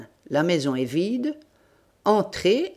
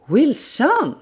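loud, high cry of terror; terrified cry; 9 semitones higher, 6 dB louder than usual. Acted. Sholmès recognising his friend, terrified shout. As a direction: shout the name in sudden fright. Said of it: "hurla-t-il terrifié".

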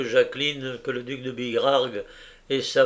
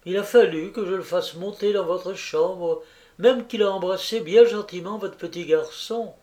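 higher, rushing chatter of a landlady; voluble landlady; 7 semitones higher than usual. A narrator's reading. Landlady chattering to Jacqueline about the visitor, voluble. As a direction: pour the words out breathlessly. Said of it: "continuait avec volubilité".

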